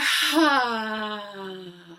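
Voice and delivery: long audible sigh out. Shown as a demonstration. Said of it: "Let out a sigh".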